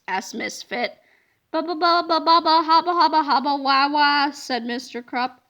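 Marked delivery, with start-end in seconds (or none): 1.5-4.3 babbling nonsense. A narrator's reading. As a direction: stammer helplessly like a stunned man.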